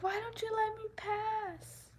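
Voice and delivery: high, fast put-on old lady voice; mock old lady. Imitating the old lady who argued in the aisle, high and fussy. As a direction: squawk like a cranky old woman. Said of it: "she's like".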